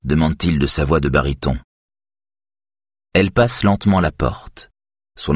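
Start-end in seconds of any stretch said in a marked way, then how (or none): none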